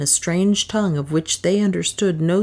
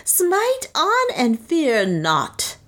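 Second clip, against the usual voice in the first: slow, high, drawn-out command; slow high priest's command; fierce priest; 9 semitones higher, much slower than usual. A narrator's reading. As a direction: urge them on slowly. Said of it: "screamed Nam".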